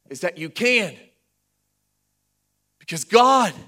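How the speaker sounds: emphatic preaching, big stressed peaks; full-force preaching. Real, not acted. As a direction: drive the point home loudly.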